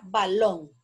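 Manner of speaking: "balón" with a slight break before a held LÓN; stress on LÓN. Shown as a demonstration. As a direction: lean on the last syllable of "balón". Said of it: "lleva la sílaba tónica en lon".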